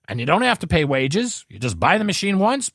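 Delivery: usual speaking voice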